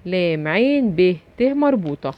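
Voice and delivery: normal talking voice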